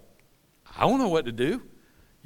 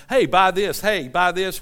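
hawker's call, louder than usual; mock yelling; 8 dB louder than usual. Market sellers yelling in church, mock yelling. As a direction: call out like a pushy vendor. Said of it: "people yelling and shouting".

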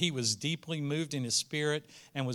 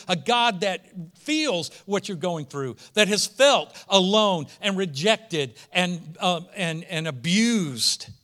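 raised, emphatic sermon delivery; forceful preaching; 4 semitones higher, 7 dB louder than usual. Real, not acted. Preacher telling listeners of a God who feels, impassioned. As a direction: preach it hard, punching the key words.

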